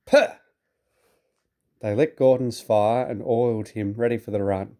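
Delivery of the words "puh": cross snort of steam; scornful puff. A narrator's reading.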